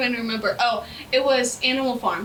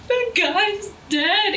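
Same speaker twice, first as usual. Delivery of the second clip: mock heartbroken; 8 semitones higher, much slower than usual. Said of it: "being like".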